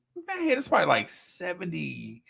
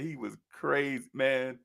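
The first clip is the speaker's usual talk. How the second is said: ordinary delivery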